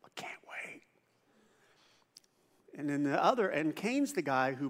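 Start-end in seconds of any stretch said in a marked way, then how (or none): 0.0-0.8 breathless whisper over the ribs; whispered craving. Retold or imitated. Picnic guest eyeing the ribs, whispered eagerly. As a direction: whisper it like you're drooling. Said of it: "you go".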